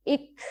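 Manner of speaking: اک said gently with a soft breathy release after the k; soft hams demo. Shown as a demonstration. Teacher showing students a hams letter, soft breathy release. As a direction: let the breath escape softly after the k in اک. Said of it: "اک، اس طرح کر کے اس کو پڑھتے ہیں".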